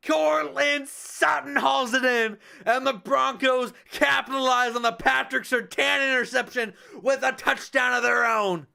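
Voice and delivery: touchdown call then measured recap; live play-by-play. Acted. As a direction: call it like a TV booth. Streamer calls the game live.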